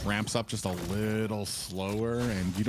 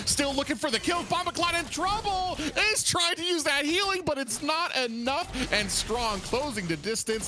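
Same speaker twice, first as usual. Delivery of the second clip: rising, excited commentary, higher and higher; live play-by-play; 10 semitones higher than usual. Acted. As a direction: call the fight live with building hype. Play-by-play of team fight.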